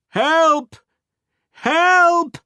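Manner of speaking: high, drawn-out call for help; cry for help. A narrator's reading. Narrator calls to the distant ship, crying out high. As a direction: call out high across the water. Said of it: "I cried out".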